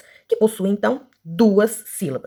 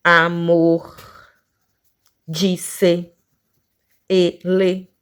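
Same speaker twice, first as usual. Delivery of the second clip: each word slowly split into two beats; level, separated syllables, word after word; much slower than usual. Shown as a demonstration. Teacher listing two-syllable words, each slowly broken in two. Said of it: "aqui nós temos".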